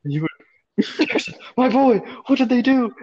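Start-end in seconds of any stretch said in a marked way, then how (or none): 1.1-2.9 high melodramatic lament; high-pitched overwrought grief; mock anguish. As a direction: cry out over a fallen boy, hammed up. Acted grief in retold scene.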